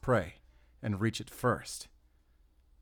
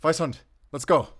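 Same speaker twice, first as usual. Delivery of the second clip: calling out; 6 semitones higher, 9 dB louder than usual. Acted. Calling the dog to follow.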